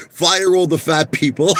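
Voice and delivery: laughing through a shouted mock order; half-laughed, half-shouted imitation of a callous CEO. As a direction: crack up while barking it as the boss. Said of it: "he said".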